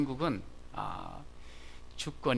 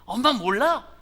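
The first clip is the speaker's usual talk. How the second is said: put-on much higher, loud boastful kid; proud and confident; 12 semitones higher, 9 dB louder than usual. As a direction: answer with a proud swagger. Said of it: "얼마나 떳떳하고 당당한지".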